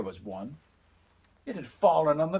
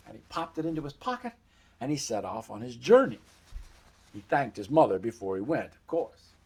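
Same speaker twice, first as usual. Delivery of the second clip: plain reading voice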